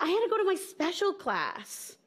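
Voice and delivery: put-on high-pitched little kid explaining; upset child. Upset kindergartner explaining to mom, acted childish upset. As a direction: explain it as an upset five-year-old. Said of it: "And I was so upset".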